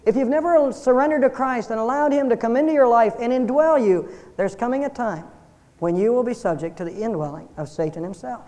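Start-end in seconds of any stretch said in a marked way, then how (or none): none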